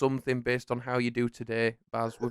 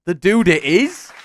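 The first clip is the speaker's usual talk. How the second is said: loud, rising game-show cry; triumphant announcement; 5 semitones higher, 10 dB louder than usual. Pretend. Host confirms the contestant's answer, loud excited announcer. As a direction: proclaim it, swooping up at the end.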